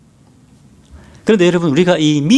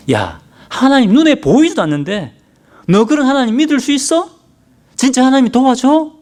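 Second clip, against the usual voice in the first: Satan's taunting voice; 8 semitones higher than usual. Retold or imitated. Satan taunting a doubting believer, higher mocking voice. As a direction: sneer like the devil testing your faith. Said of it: "사탄이 와서 사탄의 음성에".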